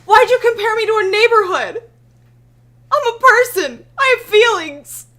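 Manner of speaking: loud, high offended protest; hurt indignation. Real, not acted. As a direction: insist on your feelings, outraged.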